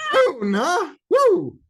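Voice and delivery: high, loud cowboy-style whoop; excited whooping. Pretend. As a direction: whoop it up over the name. Whooping over a cowboy name.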